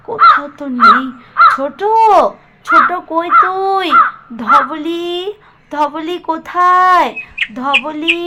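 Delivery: loud, high calling across the field. Acted. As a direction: call the cow's name far off. Calling out for lost cow. (জোরে হাঁক দিয়ে ডাকা)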